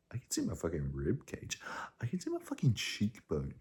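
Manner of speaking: hushed, unvoiced whisper; whispered awe. Real, not acted. To viewers, marvelling at her body, awed whisper. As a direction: breathe it out in quiet wonder. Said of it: "whispered marvel at own body".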